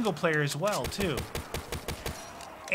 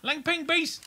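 excited callout; 12 semitones higher than usual. Acted. Shouting game callout.

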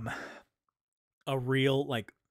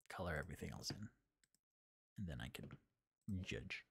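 whisper; 6 semitones lower, 13 dB quieter, faster than usual. Real, not acted. Quiet muttering to self.

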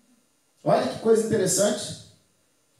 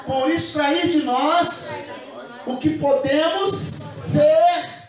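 slow call and response. Shown as a demonstration. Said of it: "Repita assim comigo".

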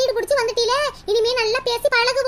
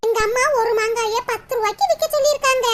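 ordinary talk, nothing marked

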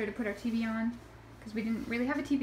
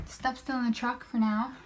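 ordinary talk

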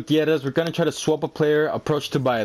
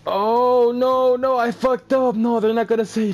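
higher, alarmed panic; dismayed outburst; 6 semitones higher than usual. Real, not acted. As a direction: blurt it in dismay. Gamer realizes transfer mistake.

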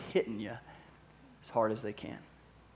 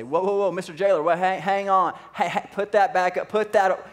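loud, high call to stop him; urgent call; 6 semitones higher, 8 dB louder than usual. Acted. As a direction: shout to stop the jailer from using the sword. Paul stopping jailer's suicide.

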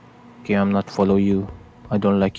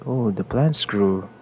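ordinary delivery

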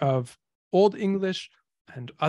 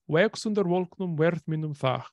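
put-on Old English recital; 2 semitones higher than usual. Shown as a demonstration. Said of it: "you basically get a line like this".

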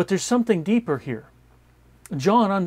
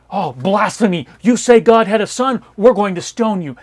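loud, indignant accusation; loud, righteous-sounding mock outrage; mock outraged accusers; 4 semitones higher, 9 dB louder than usual. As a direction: hurl the charge of blasphemy at him.

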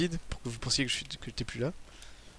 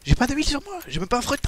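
high-pitched whine; 12 semitones higher, 5 dB louder than usual. Real, not acted. Frustrated gamer complaining mid-fight.

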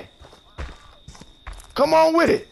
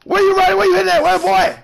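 higher, louder, calling out the runner; loud taunting call; 4 semitones higher, 3 dB louder than usual. Real, not acted. Gamer chasing a fleeing player, loud taunting call. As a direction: yell after him as he flees.

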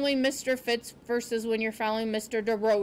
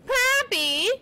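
high, drawn-out call; amused; 12 semitones higher, 4 dB louder than usual. A narrator's reading. Porcupine addressing Poppy between laughing fits, amused. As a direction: sing out the name, amused. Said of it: "said between fits of sputtering laughter".

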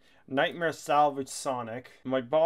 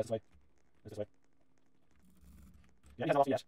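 usual speaking voice

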